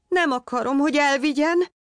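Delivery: cling and refuse in panic; desperate protest. A narrator's reading. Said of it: "tiltakozott kétségbe esetten Némó".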